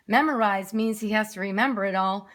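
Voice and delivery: ordinary delivery, nothing marked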